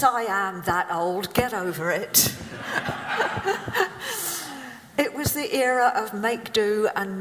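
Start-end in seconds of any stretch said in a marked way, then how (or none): 2.4-4.9 real laugh. Real, not acted. Laughs at own age joke.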